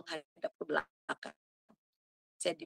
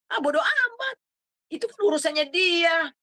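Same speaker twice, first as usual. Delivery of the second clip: high, loud scornful shrug-off; dismissive mock voice; 10 semitones higher, 9 dB louder than usual. Preacher mocking the listeners' careless excuse, high and scornful. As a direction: voice the careless 'who cares' attitude.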